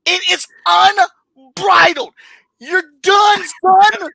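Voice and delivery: high, loud, drawn-out disbelief; outraged shouting. Real, not acted. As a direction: yell it like you've been wronged. Outraged rant over the script.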